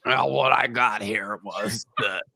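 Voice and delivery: slow, put-on man-sounding voice reading a press release; lightly voiced old man, deliberately slow; mock old man voice. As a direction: drag it out like an eighty-year-old man reading. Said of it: "This is a fictional impression".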